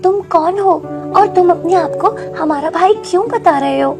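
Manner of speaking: high-pitched, bewildered questioning; astonished. Acted. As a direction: question the stranger in amazement. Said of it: "Meenu बहुत ज्यादा हैरान हो जाती है".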